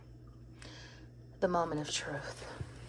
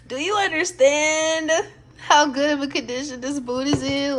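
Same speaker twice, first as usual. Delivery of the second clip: excited; 7 semitones higher, 9 dB louder than usual. Real, not acted. Repeating it to viewers, higher and laughing with excitement. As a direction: lean in and repeat it bursting with delight.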